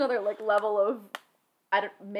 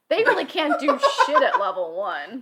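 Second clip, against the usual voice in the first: laughing; 4 semitones higher, 7 dB louder than usual. Real, not acted. Laughing at weak characters.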